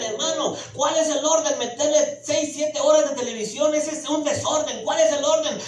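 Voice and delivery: fiery pulpit preaching; loud soapbox ranting. Acted. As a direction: preach it hard to the congregation. Preaching at full force.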